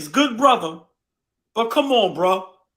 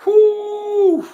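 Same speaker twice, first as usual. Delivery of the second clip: pained whoo; 9 semitones higher, 5 dB louder than usual. Real, not acted. Reacting to Jimmy Butler.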